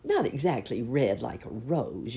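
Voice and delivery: ordinary delivery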